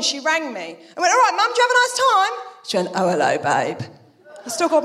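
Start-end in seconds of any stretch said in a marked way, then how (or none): 1.1-2.3 high, fast chirpy question; mock-bright phone hello; cheery greeting. Daughter ringing mum after a wedding, cheery high greeting. 2.9-3.8 very low, wrecked phone voice; poorly mum. Retold or imitated. Mum on the phone after a wedding, low poorly voice.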